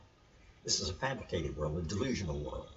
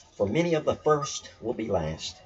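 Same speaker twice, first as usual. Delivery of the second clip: raised, louder quoted verse; scripture reading; 6 semitones higher, 7 dB louder than usual. Acted. Teacher reading the next line of the saying, lifted. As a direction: recite it like holy text. Reads second part of saying.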